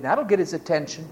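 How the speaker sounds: ordinary talk, nothing marked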